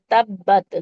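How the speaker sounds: "تب بت" with the با said hard and doubled; "تب بت" firmly stressed. Shown as a demonstration. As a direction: press hard on the با in "تب بت". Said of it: "سختی سے پڑھیں گے".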